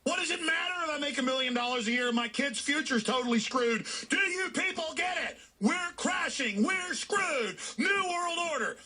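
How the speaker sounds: hammed-up desperate shouting, voice cracking; a radio host mimicking a TV guest shouting in alarm; imitating a frantic shout. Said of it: "This is a guy's like".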